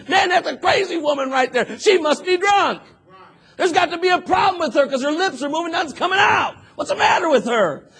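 loud, high mocking disbelief; a preacher sneering at a crazy woman; scornful preacher. Preacher scorning praying Hannah as drunk, loud and mocking. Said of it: "the preacher walks in and thinks".

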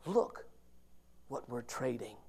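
normal talking voice

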